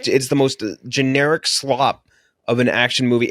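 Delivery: usual speaking voice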